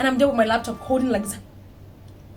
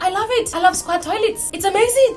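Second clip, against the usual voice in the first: high, bright-tempo gushing joy; 9 semitones higher, 3 dB louder than usual. Real, not acted. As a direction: rave about it. Now loves squat toilets.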